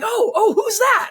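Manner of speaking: mock eager curiosity. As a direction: play the overeager local who wants the name. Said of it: "people were like".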